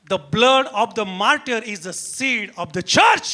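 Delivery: very slow, weighty quotation; solemn declamation. Real, not acted. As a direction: space out each key word deliberately. Declaims a famous English saying slowly.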